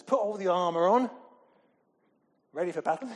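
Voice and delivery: loud rousing call to battle; rally the troops at full voice; Paul calling the troops to battle, loud. Retold or imitated. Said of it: "Paul says".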